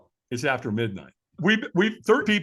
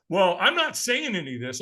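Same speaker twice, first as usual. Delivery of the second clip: usual speaking voice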